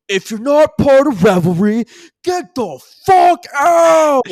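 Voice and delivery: very high, loud rowdy yell; frat bros. Acted. Frat bros shooing the crowd, loud high yell. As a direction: bellow them out the door. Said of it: "you hear some frat bros go".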